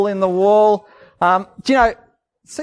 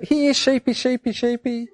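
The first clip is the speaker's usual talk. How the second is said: higher sing-song call, trailing soft; high coaxing sheep call; 6 semitones higher, 4 dB quieter than usual. Shown as a demonstration. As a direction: call the flock in gently. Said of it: "One to call the sheep".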